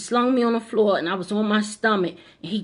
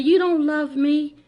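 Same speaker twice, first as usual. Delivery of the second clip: higher, slow, hurt son voice; lightly voiced sobbing son; son crying; 7 semitones higher, 3 dB louder, slower than usual. The son, crying after the attack, hurt and accusing.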